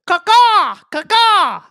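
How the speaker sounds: high loud bird caw; chirping bird person. Pretend. S0 joins bird imitations.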